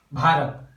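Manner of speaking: भारत said as भा-रत, with रत joined; careful demo. Shown as a demonstration. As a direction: say भारत as भा-रत, रत in one go. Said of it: "इसका उच्चारण हम इस प्रकार से करते हैं". (भारत को भा-रत, रत एक साथ, साफ़ उच्चारण में)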